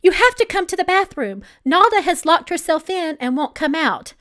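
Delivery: loud, high urgent yell; alarmed employee yelling for the boss to come. A narrator's reading. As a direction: shout it in a panic for help. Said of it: "she yelled".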